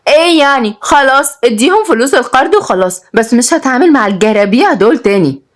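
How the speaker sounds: high, haughty heiress; arrogant. A narrator's reading. Heiress waving away the bank loan, arrogant. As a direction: dismiss it from on high. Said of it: "ردت كنزي بتكبر".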